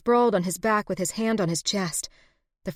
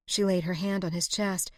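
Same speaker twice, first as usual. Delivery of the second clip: ordinary delivery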